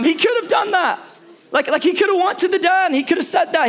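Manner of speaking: pitched well up, half-mocking. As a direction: lift the voice high like a sulky kid. Preacher voicing hypothetical son.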